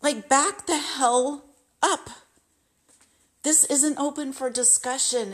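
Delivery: imagined outburst at a pushy masseuse, rising high; fed-up pretend telling-off. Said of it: "I want to say".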